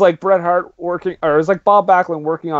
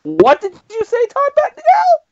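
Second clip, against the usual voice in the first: very high, squealed disbelief; hammed-up, high-pitched demand to hear it again; exaggerated shocked question; mock incredulity; 3 dB louder than usual. Acted disbelief at the announcer.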